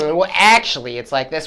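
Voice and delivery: hammed-up pretentious aside; pretentious know-it-all. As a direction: put on a condescending "well, actually". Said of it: "It would come off as pretentious. I would be like an elitist".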